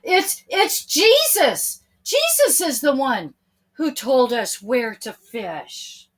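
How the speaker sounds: excited realisation. A narrator's reading. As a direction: burst out as it sinks in. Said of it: "he said".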